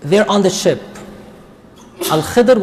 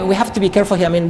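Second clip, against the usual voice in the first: normal talking voice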